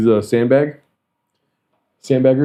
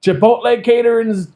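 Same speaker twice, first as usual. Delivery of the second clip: mock an excited colleague rallying everyone; hollering coworker; 10 semitones higher than usual. Continues coworker's excited food announcement.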